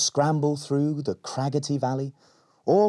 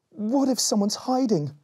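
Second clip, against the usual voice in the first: fearful cry; 12 semitones higher than usual. A narrator's reading. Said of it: "cried Berry".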